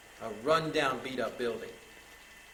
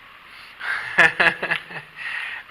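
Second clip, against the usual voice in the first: surprised laugh; 3 semitones higher, 9 dB louder than usual. Real, not acted. Reacting to swinging amulet.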